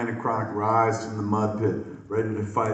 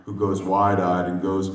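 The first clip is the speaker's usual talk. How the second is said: usual speaking voice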